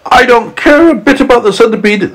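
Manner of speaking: high, wailing protest; acted tearful whine, pitched well up; storybook sobbing. A narrator's reading. Earthworm grieving for the boy, acted sobbing.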